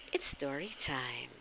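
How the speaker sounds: spooky story whisper; hushed storyteller invitation. Real, not acted. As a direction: whisper it to draw them in. Announcing story time.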